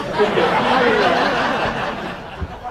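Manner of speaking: loud bright burst of laughter; a real laugh. Real, not acted. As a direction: laugh at your own pun. Laughs at his own joke.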